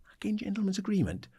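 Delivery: incredulous whispered scoff; whispered outrage. Retold or imitated. Manager to the club chief, hissing disbelief in a whisper. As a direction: spit it out in a stunned whisper.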